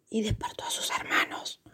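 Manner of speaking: whispered storytelling; hushed whisper. A narrator's reading. Narrator tells of waking the sleeping brothers, whispered. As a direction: drop to a secretive whisper.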